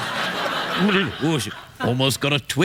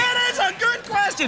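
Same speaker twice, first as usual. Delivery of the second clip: high shout. Acted.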